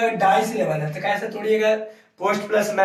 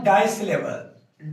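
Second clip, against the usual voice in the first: slow teaching word; 2 semitones lower, much slower than usual. Shown as a demonstration. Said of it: "दूसरा क्या पढ़ेंगे हम".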